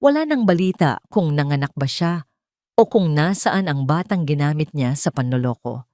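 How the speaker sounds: usual speaking voice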